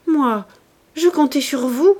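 a wronged lady pressing her claim; reproachful Madame Correur. A narrator's reading. Madame Correur scolds Rougon on the bridge, aggrieved and loud.

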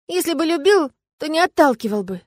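high acted tearful reproach; halting sobs. A narrator's reading. Woman reproaches the dragon for pushing her away, tearful. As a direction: reproach him through mock sobs. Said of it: "сбивчиво говорила я, всхлипывая и заикаясь".

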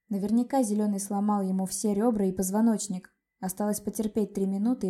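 plain reading voice

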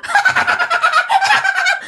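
very high, drawn-out cackle; gloating spirit cackle; eerie ghost laugh. Acted. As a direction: laugh madly in a piercing high pitch. Spirit laughs after haunting reveal.

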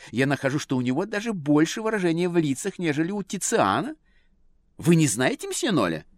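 high, affected admiring lady; society lady voice. A narrator's reading. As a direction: rave like a fussy socialite. Lady raves about painter.

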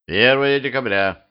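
usual speaking voice

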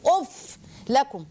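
ordinary talk

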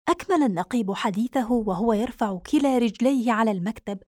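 plain reading voice

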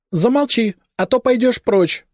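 very high stern mother's rebuke. A narrator's reading. Mother hushing her chattering daughter, stern. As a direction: silence the daughter sharply. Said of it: "строго сказала маман".

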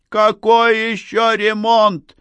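high, loud, drawn-out indignant yell. A narrator's reading. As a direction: yell it in exasperation. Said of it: "заорала Надежда". (возмущённый вопль)

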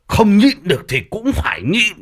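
insistent overruling. Acted. Father-in-law ordering wife to endure it, insistent. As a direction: firmly shut down the protest, acted.

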